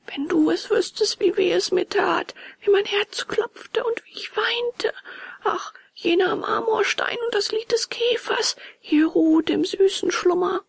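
put-on high, plaintive child's lament; high-voiced acted weeping; tearful puppet boy. A narrator's reading. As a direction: tell the hurt through tears, pitched high. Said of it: "Mit Tränen in den Augen".

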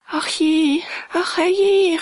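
the housekeeper panics in dismay; frightened cry. A narrator's reading. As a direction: housekeeper crying out in fright at her master's state. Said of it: "rief sie".

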